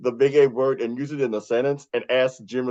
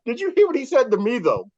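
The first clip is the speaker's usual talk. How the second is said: high, quick protest tipping into laughter; playful outraged tattling; mock indignant; 9 semitones higher, 3 dB louder, faster than usual. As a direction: complain in a high, hurried voice. Mock complaint quoted about co-host.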